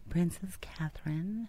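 slow, hushed whisper of admiration; mock eloquence. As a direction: echo it in a soft, elegant whisper. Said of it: "you say that so eloquently".

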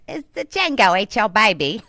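loud, hammed-up Australian accent; mock Australian accent. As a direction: slip into a silly Australian accent. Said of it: "Again, wrong hemisphere".

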